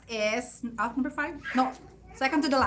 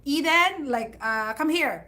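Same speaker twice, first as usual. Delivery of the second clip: mom calling out; 4 semitones higher, 5 dB louder than usual. Retold or imitated. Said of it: "my mom would call my name".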